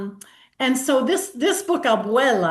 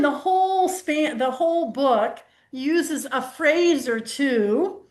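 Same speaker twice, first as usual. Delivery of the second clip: ordinary talk, nothing marked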